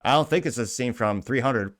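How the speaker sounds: ordinary talk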